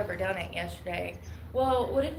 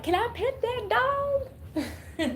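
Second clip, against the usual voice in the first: very high, slow pleading squeak; high drawn-out meme voice; much slower than usual. Retold or imitated. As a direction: stretch it out like a dazed child. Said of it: "And she's like".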